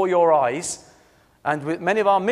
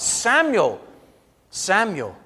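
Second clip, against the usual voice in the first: emphatic call, higher and louder then easing; 4 semitones higher, 4 dB louder, slower than usual. Acted. The Lord calling the boy Samuel, emphatic call. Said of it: "calling out at other times".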